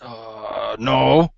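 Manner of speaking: put-on voice of a puzzled helper; slow-witted helper character. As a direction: answer unsure, in a put-on voice. Same character answering the player.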